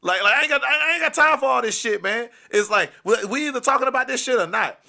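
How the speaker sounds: high, loud, fed-up outburst; loud angry tirade. Retold or imitated. Boyfriend fed up with waiting to talk, loud and angry. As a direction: rant with no patience left. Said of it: "I was being so loud".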